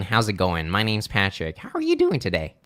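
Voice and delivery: hammed-up, sing-song sales opener; exaggerated phone greeting. Shown as a demonstration. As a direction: overdo the friendly greeting. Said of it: "I'm kinda exaggerating it".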